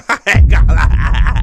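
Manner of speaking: loud, high burst of laughter through the words; can't get it out for laughing. Real, not acted. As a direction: crack up loudly while talking. Laughing hard at the clip.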